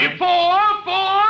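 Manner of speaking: loud warning shout of fore; calling fore. Acted. Golf pro shouting a warning at the players. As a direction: yell fore across the course. Golf ball heading at people.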